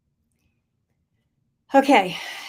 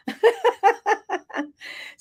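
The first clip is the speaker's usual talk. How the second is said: high delighted laugh; 14 semitones higher than usual. Real, not acted. Laughs after excited quote.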